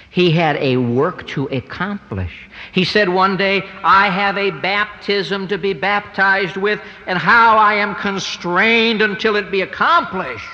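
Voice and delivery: rising, ringing preacher's proclamation; sermon climax, voice lifted high and strong; preaching at full force. Acted. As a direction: build it up line by line from the pulpit. Preaching on accomplishing the work.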